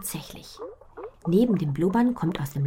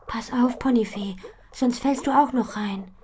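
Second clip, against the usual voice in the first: a touch higher, urgent warning; warning friend; 8 semitones higher, 6 dB louder than usual. Acted. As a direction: hold her back, urgently. Freundin warnt am Brunnenrand. (Butterblume warnt Ponifee am Brunnen, dringlich)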